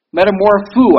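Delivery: "Metamorpho" said loud and deliberately, ending on a falling tone; careful pronunciation. Shown as a demonstration. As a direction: sound out "Metamorpho" as a Greek word, loudly. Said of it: "as it's pronounced".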